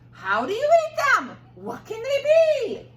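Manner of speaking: very high, loud squeaky wonder; puzzled townspeople. Acted. Townspeople baffled by the strange food, very high and loud.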